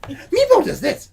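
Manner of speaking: high, slow goofy creature voice; Meepo voice. Retold or imitated. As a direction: squeak it out like a little kobold. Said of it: "sounded more like Meepo".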